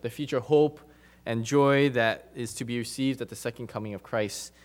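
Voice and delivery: ordinary talk, nothing marked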